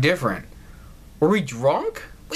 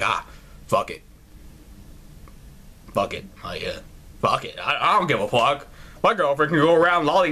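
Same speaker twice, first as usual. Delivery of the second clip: imitating careless boyfriend; 4 semitones lower, faster than usual. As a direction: shrug it off like a slacker. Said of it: "he's just gonna be like".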